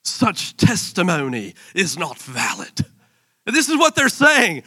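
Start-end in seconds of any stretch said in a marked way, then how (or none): 0.0-2.9 slow mock English accent. Pharisees replying to Jesus, in a mock English accent. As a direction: put on a stately English accent, drawn out. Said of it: "said it with an English accent".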